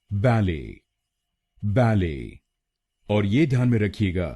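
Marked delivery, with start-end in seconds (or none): 0.1-2.4 बैले joined up, first syllable stressed; BAL-ay with silent T for बैले; British बैले, falling after the first syllable; British pronunciation. Shown as a demonstration. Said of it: "British pronunciation इसको बोलेंगे".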